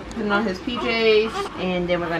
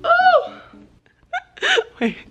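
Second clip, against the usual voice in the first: pained laughing after surgery; 3 dB louder than usual. Real, not acted. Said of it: "I can't laugh, it hurts".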